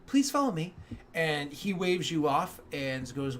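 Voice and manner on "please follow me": polite clerk. Acted.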